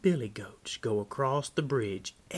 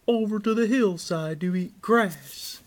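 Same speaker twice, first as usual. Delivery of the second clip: raised, projected goat voice; goat's reply; 6 semitones higher, 6 dB louder than usual. A narrator's reading. Big Billy tells the troll his plan, high goat voice. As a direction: answer higher and louder, as a billy goat.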